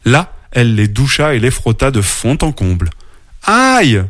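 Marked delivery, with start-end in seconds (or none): 3.4-4.0 high, short cry of ouch; pained yelp. A narrator's reading. Little bear being scrubbed at the waterfall, complaining yelp. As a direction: yelp in storybook pain. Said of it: "se plaignit petit ours".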